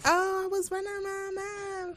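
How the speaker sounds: high, slow mocking echo; mock indignant. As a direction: echo it back in a high sassy voice.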